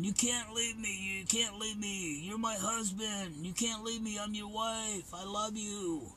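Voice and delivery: high-pitched pleading scream, imitating her; wailing high like the desperate wife; mock screaming wife. Wife begging husband not to leave, high mock screaming. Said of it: "she started screaming".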